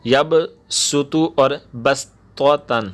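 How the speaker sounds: each syllable drawn out slowly; slow Quranic word demo; slow recitation. Shown as a demonstration. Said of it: "جو number ایک اور دو میں ہے نا".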